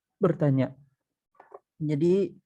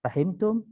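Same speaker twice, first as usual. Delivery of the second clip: "Fahimtum" pitched up, rising as a question; questioning intonation; 4 semitones higher than usual. Shown as a demonstration. Teacher showing the particle is understood, questioning intonation. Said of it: "Anda sedang bertanya".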